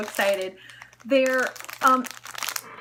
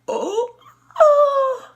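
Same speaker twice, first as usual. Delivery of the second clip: high, loud little squeal; excited squeal; 12 semitones higher, 10 dB louder than usual. Real, not acted. Vlogger squealing over a squishy charm, high and loud. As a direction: squeak with delight.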